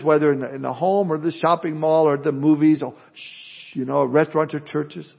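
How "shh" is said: soft unvoiced shush. Parent hushing a child at the movies, soft shush. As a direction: hush someone in a quiet place. Shushing noisy kids in church.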